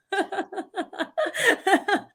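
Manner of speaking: high burst of giggling; real laugh in a quick run. Real, not acted. Podcaster amused by her own story, laughing.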